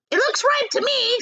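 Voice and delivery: put-on high, mean old aunt; the greedy aunt, pitched high; aunt's voice. Aunt Spiker eyeing the peach, high and greedy. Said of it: "said Aunt Spiker".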